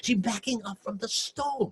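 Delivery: plain reading voice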